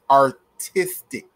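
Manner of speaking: heavy, deliberate emphasis on "artistic"; stress on artistic. Real, not acted. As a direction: hit "artistic" hard, stretching it syllable by syllable. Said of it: "Emphasis on artistic".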